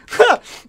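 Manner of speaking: high burst of shouted laughter; loud laugh. Real, not acted. Gamer laughing loudly after beating an enemy, a high burst of laughter. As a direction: let out a big victorious laugh.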